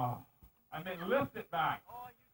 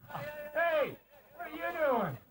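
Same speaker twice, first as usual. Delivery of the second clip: alarmed shout; 8 semitones higher, much slower than usual. Real, not acted. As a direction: yell them to a halt. Host stops a false start.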